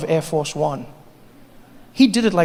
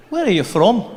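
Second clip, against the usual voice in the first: Scottish shopkeeper; 5 semitones higher, 4 dB louder than usual. Retold or imitated. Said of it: "They'd be like".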